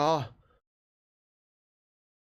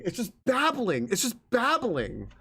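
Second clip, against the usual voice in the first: exasperated complaint; 5 semitones higher than usual. Real, not acted. Complains about the endless prose.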